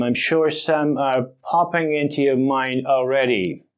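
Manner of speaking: ordinary talk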